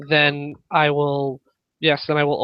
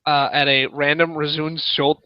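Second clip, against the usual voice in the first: ordinary talk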